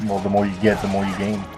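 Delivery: ordinary delivery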